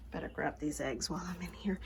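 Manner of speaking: soft unvoiced whisper near the hens. Real, not acted. Farmer whispering while grabbing eggs from the hens. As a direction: hush it so the chickens stay calm.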